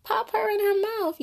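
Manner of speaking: very high, shocked, indignant voice; hammed-up high scandalized gasp of disapproval; scandalized onlooker. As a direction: play the outraged aunties watching. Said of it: "we were like".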